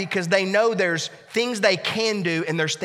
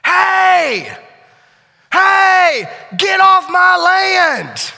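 yell; 12 semitones higher, 9 dB louder, much slower than usual. Retold or imitated. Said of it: "we heard yells".

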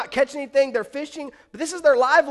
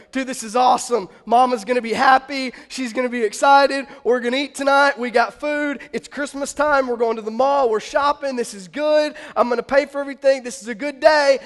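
louder, gleeful rush of good news; so excited; 2 semitones higher, 5 dB louder than usual. Acted. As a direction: play a thrilled fisherman celebrating his catch. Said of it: "He's so excited. He's like".

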